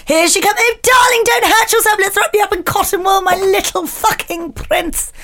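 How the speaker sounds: put-on high, fussing mother crooning over her child; fussing helicopter mum. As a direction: coo and fuss like an overprotective mum. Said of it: "the helicopter parent is landing".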